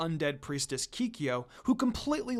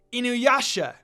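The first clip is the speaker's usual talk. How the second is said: louder, higher call across the clearing; 6 semitones higher, 6 dB louder than usual. Acted. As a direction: call his name to stop the kiss. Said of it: "his name being called out".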